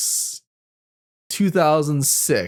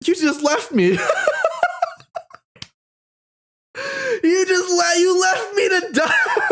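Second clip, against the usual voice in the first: high, laughing complaint; laughing mock accusation. Brother Kevin accusing her, high and laughing. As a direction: play the wronged brother through laughter. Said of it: "he said to me".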